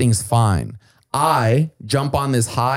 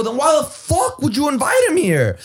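high, exasperated demand; perplexed and angry at the frat kids; outraged; 13 semitones higher than usual. Retold or imitated. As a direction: fling the question back at them in disbelief. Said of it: "And I go".

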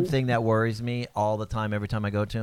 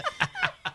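squealed burst of laughter; high shrieking laugh; 7 dB quieter than usual. Real, not acted. Laughing at the joke.

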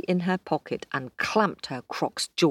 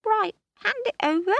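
very high, odd little croc voice; the cheeky croc demanding the chocolate; faster than usual. Acted.

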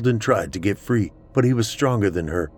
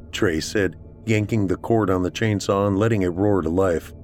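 ordinary delivery

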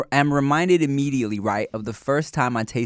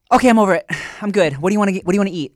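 higher, louder breezy chirp; suddenly cheerful, as if nothing happened; mock breezy ex; 6 semitones higher, 5 dB louder, much faster than usual. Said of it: "and be like".

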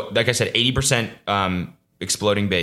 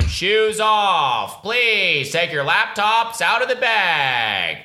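high, slow mock officious bark; mocking TSA agent; 12 semitones higher, much slower than usual. Mocking TSA screeners in a high, slow nagging voice. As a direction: imitate a nagging airport guard. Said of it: "TSA screaming at you".

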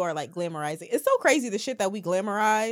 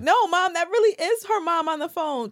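put-on protesting teen; 9 semitones higher than usual. Teen lying to mom about a phone call, insistent protest. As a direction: plead your case like a cornered kid.

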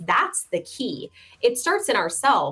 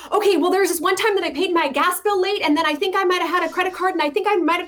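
higher, rushed, tumbling excuses; anxious borrower blurting excuses, pitched up and hurried; nervous client rambling; 8 semitones higher, much faster than usual. Retold or imitated. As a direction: gabble out nervous justifications fast.

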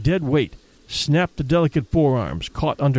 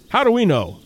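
higher, urgent cry; a guide crying out to his partner, higher and urgent; cried out; 7 semitones higher than usual. A narrator's reading. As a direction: cry it out in alarm. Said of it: "cried Travis".

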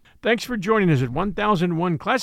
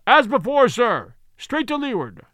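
higher, louder call from aloft; lookout calling down; 6 semitones higher, 4 dB louder than usual. Acted. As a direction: shout the answer down. Lookout answers from mast.

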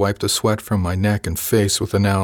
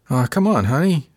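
higher-pitched coaxing, drawn onto "honey"; wheedling; 6 semitones higher than usual. A narrator's reading. Crewman pestering a woman on the rowing machine, wheedling. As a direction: wheedle sweetly, pitch swooping up. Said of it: "Apones wheedled".

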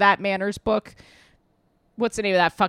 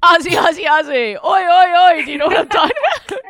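loud, high Australian chant; Aussie cheer; 10 semitones higher, 8 dB louder than usual. Pretend. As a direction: shout it like an Aussie sports fan. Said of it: "doing the Aussie".

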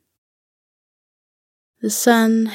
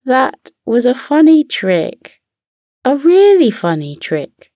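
high, loud giggly delight; giggling; 7 semitones higher, 5 dB louder than usual. Acted. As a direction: giggle through the words. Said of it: "Giggles started to giggle".

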